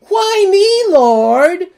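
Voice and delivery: high, loud whiny plea of why me; mock desperate complaint. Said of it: "do not go to God saying".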